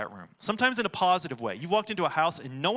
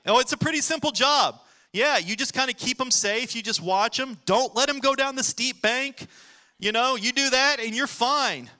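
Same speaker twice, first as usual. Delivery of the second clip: higher, louder, breezy job pitch; breezy boss; 7 semitones higher, 4 dB louder than usual. Retold or imitated. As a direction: sell the easy job like a cheery boss. Hiring boss explains the job.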